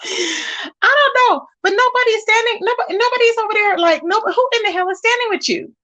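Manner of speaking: high, laughing through the words; crying with laughter, disbelieving; laughing exasperated. Real, not acted.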